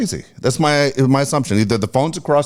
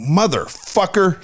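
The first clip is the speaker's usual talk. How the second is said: drawn-out mock curse, higher pitch; retold cursing; 7 semitones higher, slower than usual. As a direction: stretch out the swear in mock fury. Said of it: "like that, right into the phone".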